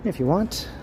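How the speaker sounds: usual speaking voice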